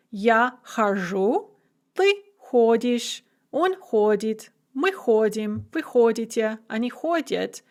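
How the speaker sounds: each form spaced out and slow, like a teacher reciting; slow conjugation drill. Shown as a demonstration. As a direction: recite хожу, ходишь, ходит slowly for learners. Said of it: "So we have chodit".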